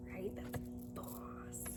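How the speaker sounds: breathy murmur under the breath; hushed little aside; whispered aside. Real, not acted.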